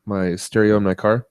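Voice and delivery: normal talking voice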